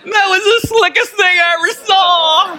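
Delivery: put-on high, loud child's voice, cracking into laughter; delighted little girl. Granddaughter eyeing grandpa's strawberry lemonade, high squealing child voice with laughter. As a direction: squeal it like a thrilled kid spotting a treat. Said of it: "she spots my drink and goes".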